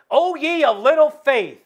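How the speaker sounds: high, loud, slow exasperated rebuke; preacher booming the rebuke; rising rebuke. Acted. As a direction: hammer the rebuke home. Jesus's reply, third time.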